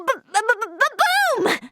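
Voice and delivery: stuttering build-up bursting into a high boom; excited boom. Acted. Recounting the fun with an explosion noise, excited. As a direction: imitate an explosion with childlike excitement.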